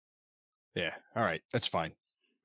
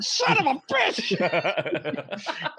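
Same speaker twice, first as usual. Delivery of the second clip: cabbie curse; 6 semitones higher, much slower than usual. Retold or imitated. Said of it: "And he's like".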